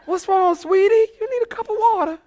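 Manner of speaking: very high put-on sweet voice; mock concerned question. Preacher acting out asking yelling woman about water, high mock concern. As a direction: squeal it high, feigning concern. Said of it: "I wanted to ask her".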